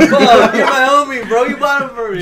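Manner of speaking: mock pleading friend. Imagined pleading with arresting cop.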